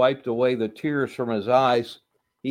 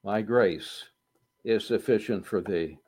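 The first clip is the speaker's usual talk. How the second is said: hushed scripture; 6 semitones lower, 6 dB quieter than usual. Acted. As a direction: lower and softer, reverent. Quiet scripture quote.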